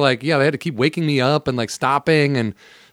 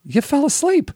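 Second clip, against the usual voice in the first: high mock nurse scolding; a nurse chiding him, pitched way up; 13 semitones higher than usual. Teasing the nurse who woke him, high voice. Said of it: "she leans over and she goes".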